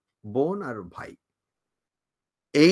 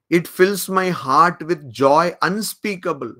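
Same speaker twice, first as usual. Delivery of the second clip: extremely slow, each word drawn out; slow solemn quote; 3 semitones higher, 5 dB louder, much slower than usual. Retold or imitated. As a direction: savour the words as a sacred quotation. Quoting Vivekananda again. (অত্যন্ত ধীরে, গম্ভীর উদ্ধৃতি)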